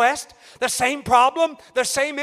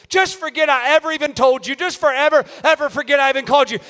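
rising, louder preaching cry; preaching at full force; 4 semitones higher, 5 dB louder than usual. Acted. Preacher to congregation, building to a loud preaching cry. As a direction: build the sermon up into a shout.

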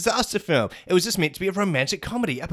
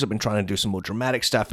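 Thanos; 7 semitones lower, faster than usual. Acted. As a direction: put on a big low Mad Titan voice. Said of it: "interview with Robert Downey Junior and Thanos".

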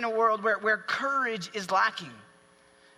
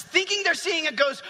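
normal talking voice

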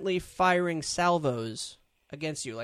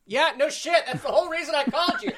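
laughing; 8 dB louder than usual. Real, not acted. Loud laughing outburst.

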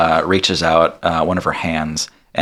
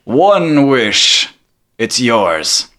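lightly voiced genie, raised pitch on the opening; a touch of grand genie pronouncement; Genie granting a wish; 5 semitones higher, 3 dB louder, much slower than usual. Genie rewarding the one who summoned him, lightly grand pronouncement.

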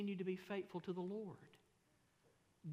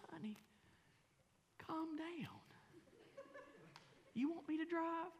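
soothing wife; 13 semitones higher, 4 dB quieter, slower than usual. Retold or imitated. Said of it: "My wife says".

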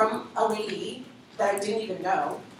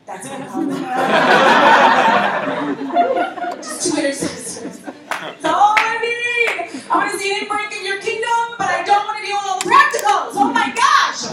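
laughing excitedly; 11 semitones higher, 10 dB louder than usual. Real, not acted. Speaker recalls her calling, laughing with excitement. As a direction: gush with delighted laughter at the calling. Laughing about the Lord's call.

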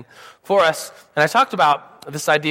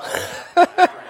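laugh. Real, not acted. Laughing at staged joke.

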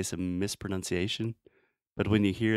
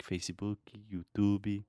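put-on Brazilian Portuguese accent, FAY-see-booky, you-TOO-bee; 4 dB quieter than usual. Teacher imitates Brazilian learners, Brazilian accent. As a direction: add Brazilian end vowels to Facebook and YouTube. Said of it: "the way that Brazilians pronounce things".

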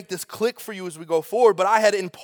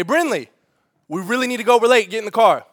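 a stern dad, a bit louder; firm parent telling his daughter to get in the car, stern; 5 semitones higher, 6 dB louder than usual. Retold or imitated. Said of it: "gets stern".

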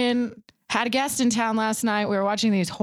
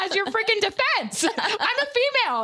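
annoyed woman; 12 semitones higher than usual. Retold or imitated. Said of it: "she's like".

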